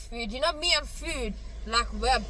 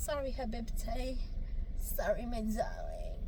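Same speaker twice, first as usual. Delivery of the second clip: whispering softly; whispered apology; 10 dB quieter than usual. Real, not acted. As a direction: breathe it out in a whisper. Soft apology.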